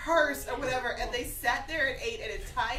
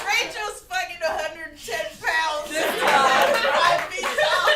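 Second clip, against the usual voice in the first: louder, laughing exclamation; laughing shout; 6 semitones higher, 11 dB louder than usual. Real, not acted. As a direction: blurt it out through laughter. Laughing outburst about tiny Rachel.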